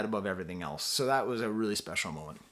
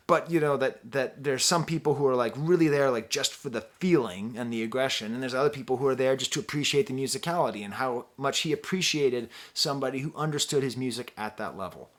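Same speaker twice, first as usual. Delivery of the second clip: usual speaking voice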